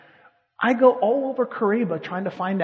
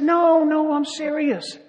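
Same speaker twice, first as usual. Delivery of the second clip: insistent protest; 10 semitones higher, 4 dB louder, slower than usual. Retold or imitated. Insisting to doubting locals.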